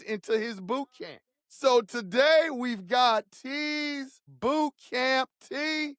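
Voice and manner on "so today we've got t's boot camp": announcer voice. Acted. Announces the boot camp segment.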